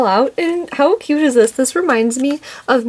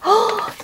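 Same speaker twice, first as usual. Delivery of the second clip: sharp high gasp of delight; 11 semitones higher than usual. Real, not acted. Vlogger seeing the toy, sharp gasp.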